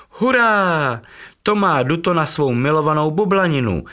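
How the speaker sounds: squealing with happiness at the surprise; joyful cheer. Acted. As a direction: cheer like delighted little chicks. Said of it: "volala dvojčátka".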